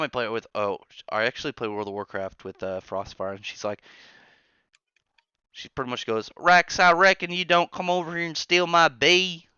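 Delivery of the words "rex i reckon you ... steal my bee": higher, louder mock Southern twang; Southern accent. Streamer imitating Southern friend Frostfire, higher mock Southern twang. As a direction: imitate a Southern friend nagging mid-raid. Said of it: "you do have an accent".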